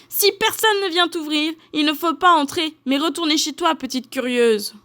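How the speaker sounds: high-pitched telling-off; scolding mama bear. A narrator's reading. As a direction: lecture her as a cross mother bear.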